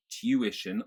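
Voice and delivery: "tuition" as "tyoo-ition"; a clear Y after the T in "tuition"; Y sound. Shown as a demonstration. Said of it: "There's a y sound".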